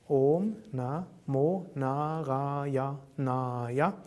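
Om Namo Narayanaya slowly split into its eight syllables. Shown as a demonstration. Teacher showing the mantra's eight syllables, Om Namo Narayanaya said syllable by syllable. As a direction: count out Om Namo Narayanaya in slow, separate syllables. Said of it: "the mantra with eight syllables".

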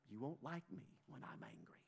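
hushed, threatening whisper; a mock-menacing whisper about anger. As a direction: breathe it like a quiet warning. Hulk line quoted mid-sermon.

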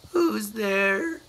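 small, high and sorry for himself; a squeaky sad little voice. Acted. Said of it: "a sad, squeaky sort of voice".

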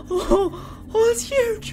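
extremely high, near-crying squeal; gasping terror. Real, not acted. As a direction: gasp and squeak in real fright. Reaper appears close by.

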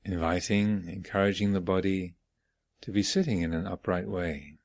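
unhurried phrases with long silences between; guided meditation. Real, not acted. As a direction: guide them gently inward, leaving space after each phrase. Leads guided morning meditation.

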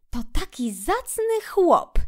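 high otter voice, musing; thoughtful remark. A narrator's reading. Otter reflects on Toad's character, thoughtfully. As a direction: weigh it up aloud. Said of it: "zauważyła wydra po namyśle".

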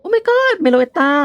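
gasp, then a high, slow, breathless oh my god; drawn-out awe at a legendary find; awestruck gasp. Acted. Trainer spotting Meloetta on the roof, awestruck gasp.

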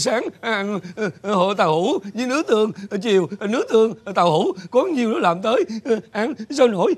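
halting list broken by sobs; unstoppable crying. Acted. Father complains about his daily meals, sobbing. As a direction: tearfully stammer out the grievance. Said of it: "ông khóc nín không dứt luôn".

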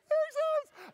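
very high, loud victory shout; overjoyed crowing squeal; elated cheer. Retold or imitated. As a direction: scream it triumphantly. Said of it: "I was so happy".

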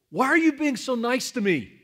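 high, loud barked question; imitated bark. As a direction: bark it like a baffled, upset stranger. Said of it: "She, like, barked at me".

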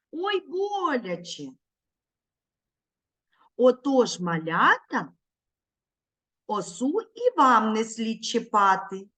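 rhythmic, sing-song poem reading; poem for children. Shown as a demonstration. Teacher reciting a poem to young children, sing-song. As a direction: read the verse with a bouncing beat. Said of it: "повторяйте за мною".

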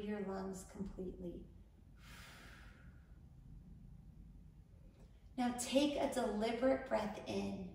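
unhurried instructions, leaving room to breathe; guided meditation. Acted. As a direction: guide a panicked listener calmly through each breath.

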